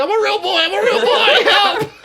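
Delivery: high, loud squeaky puppet cries, laughing; hammed-up Pinocchio begging. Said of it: "turn into Pinocchio".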